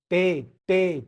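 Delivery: टे said as "tay", loud; टे hit clearly, twice; German T. Shown as a demonstration. Teacher drilling students, loud clear टे. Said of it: "टी नहीं है".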